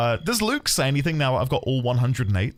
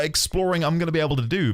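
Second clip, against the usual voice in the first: usual speaking voice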